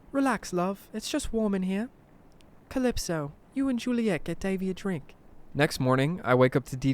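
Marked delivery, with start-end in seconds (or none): 0.1-5.1 put-on high-pitched English old-lady voice; sweet English granny, pitched high. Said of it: "She's originally from England".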